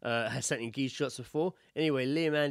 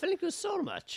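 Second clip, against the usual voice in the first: much higher, sweet gushing thanks; hammed-up gratitude to the dentist; mock gushing thanks; slower than usual. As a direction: gush at the dentist like a delighted patient.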